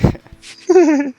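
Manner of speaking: éclat de rire aigu. Real, not acted. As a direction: rire perçant qui part haut. (éclat de rire aigu et fort)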